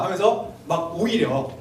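ordinary talk

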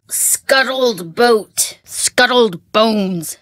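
drawn-out, careful guessing; slow guessing. Acted. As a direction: sound out each guess slowly. Guessing the password.

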